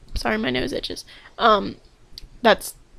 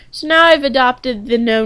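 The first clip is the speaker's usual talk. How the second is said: ordinary delivery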